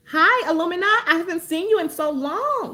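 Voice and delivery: loud, high happy hello; thrilled to see a chat regular; excited greeting. Real, not acted.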